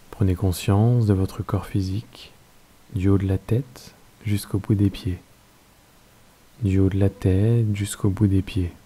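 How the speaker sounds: calm, unhurried Yoga Nidra guidance with long pauses; guided meditation. Acted. Teacher settling listeners into Yoga Nidra, guided meditation voice.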